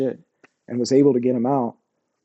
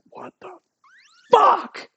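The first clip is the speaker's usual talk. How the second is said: stunned what the fuck; 3 dB louder, slower than usual. Retold or imitated. Shock at the soldier's gesture.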